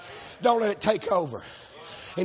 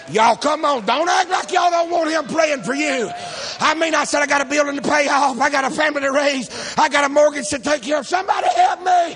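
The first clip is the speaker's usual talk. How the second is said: higher and louder, shouting the point home; preaching at full force; 5 semitones higher, 5 dB louder than usual. Real, not acted. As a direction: preach it to the back row.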